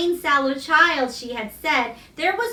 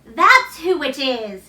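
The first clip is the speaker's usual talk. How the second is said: excited cry; 3 semitones higher, 6 dB louder than usual. A narrator's reading. Dickon running to greet his mother, crying out happily. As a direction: cry out with delight at seeing her. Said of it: "he cried".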